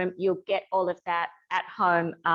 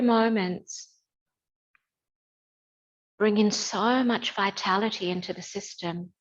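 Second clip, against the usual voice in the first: guided meditation. Acted. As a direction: guide them gently into stillness. Leads a gentle standing meditation.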